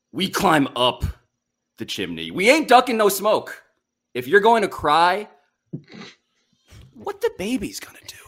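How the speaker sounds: big broadcast intro with an overlong dramatic pause; dramatic announcer read. Shown as a demonstration. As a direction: pause way too long before the chimney. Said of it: "Pretend like you're introducing this on E S P N Daily".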